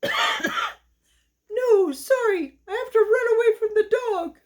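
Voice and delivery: a scared-busy cat rushing off; kitty in a hurry. Acted. Cat fleeing the dog.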